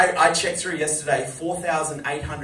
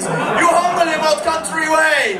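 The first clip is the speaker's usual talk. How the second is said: Sheik ranting; 12 semitones higher, 9 dB louder than usual. Retold or imitated. Imitating the Sheik yelling at Howard Stern, high loud rant. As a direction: yell it like a wild old wrestler laughing. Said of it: "He's like".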